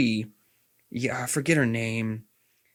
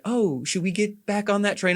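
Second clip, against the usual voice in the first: higher, coaxing mock voice; flirty Liara; 9 semitones higher than usual. Liara coaxing Shepard back, higher mock-sweet voice. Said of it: "she's like".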